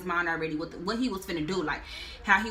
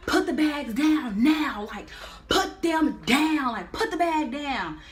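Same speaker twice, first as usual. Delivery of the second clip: slow, louder commanding guard voice; stern order; 6 semitones higher, 4 dB louder, slower than usual. Retold or imitated. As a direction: bark the order slowly like a guard. Security guard ordering them.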